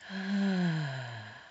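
slow audible exhale on a falling "ahh"; calming meditation sigh; long relaxing sigh. Shown as a demonstration. Demonstrates the deep breath out.

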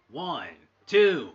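loud, high-pitched count; excited count. Acted. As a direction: belt out the count. Count in commentary.

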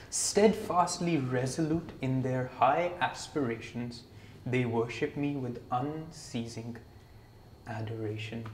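slow, soft, measured verse reading; hushed and unhurried scripture; scripture recitation. Real, not acted. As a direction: recite it reverently, word by word, letting it sink. Reads a verse aloud.

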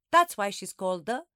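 put-on old woman reading verse, loud first word; quavering recital, left hanging; quavering old lady. A narrator's reading. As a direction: build suspense towards the last word. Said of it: "being read out in her quavering voice".

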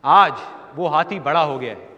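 normal talking voice

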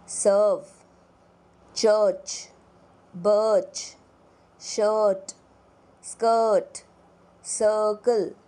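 सर्व, चर्च, शर्ट with a long er; teacher models the er vowel; er vowel demo. Shown as a demonstration. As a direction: hold the central er sound. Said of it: "er vowel word list".

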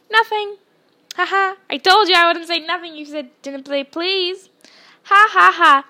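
very loud, high triumphant crowing; shouted taunt with gleeful fake laughter; gloating Peeves. A narrator's reading. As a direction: jeer with big mocking ha-has. Peeves gloats at tricked Filch.

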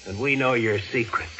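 ordinary talk, nothing marked

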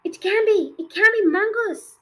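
high, loud terrified exclamation; horrified headmistress; panicked cry. A narrator's reading. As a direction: shriek in disbelief. Trunchbull panics at the ghost.

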